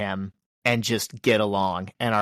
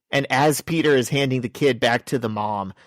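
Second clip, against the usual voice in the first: usual speaking voice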